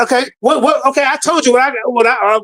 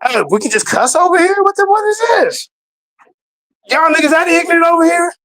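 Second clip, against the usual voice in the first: mock outraged shout; 7 semitones higher than usual. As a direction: yell it like you can't believe what you're hearing. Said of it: "that's the energy I went into".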